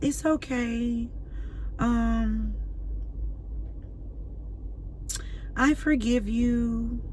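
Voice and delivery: slow, soft, sugary fake forgiving; mock forgiveness. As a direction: mimic a saintly pushover, drawn out slow. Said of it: "supposed to be like".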